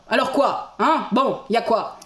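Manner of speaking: badly imitated grumpy, punchy questions; Bakri. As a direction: jab out short irritable questions. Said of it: "on part un peu sur du Bakri".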